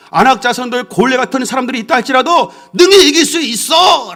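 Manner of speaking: high, loud preaching that keeps pushing; fiery preaching. Acted. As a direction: preach it out with full force. Preaching at full force.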